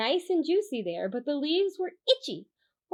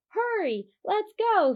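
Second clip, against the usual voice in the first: eager urging; 9 semitones higher, 4 dB louder than usual. Acted. Deer urges friend on.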